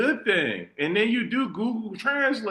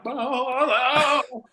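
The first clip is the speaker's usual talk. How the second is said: higher drawn-out mock boo; a long booing call; mock Spanish boo; 5 semitones higher, much slower than usual. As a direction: imitate a booing heckler. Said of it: "He was like".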